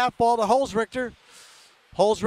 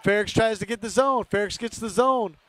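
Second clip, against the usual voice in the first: usual speaking voice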